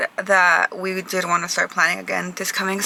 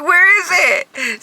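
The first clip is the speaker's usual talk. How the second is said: puzzled; 9 semitones higher, 3 dB louder, much slower than usual. Retold or imitated. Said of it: "I'm like".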